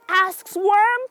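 ordinary delivery, nothing marked

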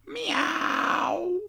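thin, drawn-out kitty cry; cat meow. A narrator's reading. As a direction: meow like a cat, long and high. Cat imitation in notebook reading.

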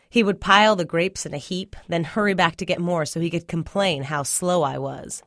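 usual speaking voice

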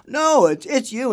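high, loud burst of certainty; imitating an insistent director, voice leaping up; excited insistence. Director correcting the confused painter, loudly insistent.

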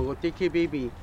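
normal talking voice

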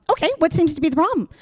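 brisk customer service chirp; office voice. Retold or imitated. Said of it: "same voice".

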